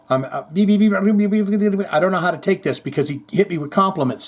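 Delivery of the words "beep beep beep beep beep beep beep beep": high run of rapid beeps; rapid beeping. Pretend. Host flustered by a viewer's comment, high rapid beeping. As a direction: sputter out a string of quick beeps.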